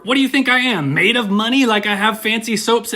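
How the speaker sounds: high, mock-exasperated comeback; indignant joke turtle. Imagined turtle protesting about soap, high mock exasperation. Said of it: "expect the second turtle to say something".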